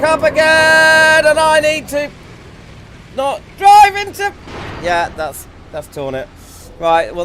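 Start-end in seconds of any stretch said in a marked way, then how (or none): 0.0-4.3 alarmed cry. Real, not acted. About to crash in race.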